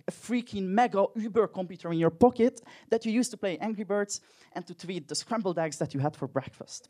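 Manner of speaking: usual speaking voice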